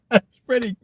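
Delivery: high-pitched words swallowed by laughter; real hard laughing fit, sentence left unfinished; can't stop laughing. Real, not acted. Streamer trying to praise the joke, laughing uncontrollably. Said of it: "I can't stop laughing right now".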